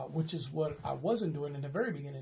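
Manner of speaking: usual speaking voice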